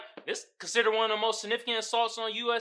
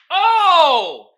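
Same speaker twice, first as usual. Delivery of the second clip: dramatic oh; 8 semitones higher, 12 dB louder than usual. Real, not acted. Dramatic exclamation.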